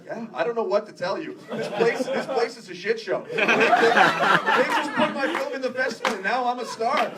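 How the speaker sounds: loud, high, hammed-up boasting; building to a full-volume mock brag; sincere in character. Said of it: "I was being sincere in, in my character".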